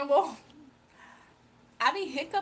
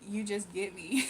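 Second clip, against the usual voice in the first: normal talking voice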